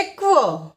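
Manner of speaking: ક્વ as one "kwuh"; phonic Q sound. Shown as a demonstration. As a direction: sound out ક્વ for learners, not "kyu". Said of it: "ક્યુનો sound થશે". (ક્વ નો phonic ઉચ્ચાર)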